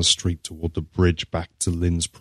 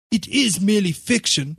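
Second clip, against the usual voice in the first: almost whining; 14 semitones higher than usual. A narrator's reading. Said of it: "almost whining".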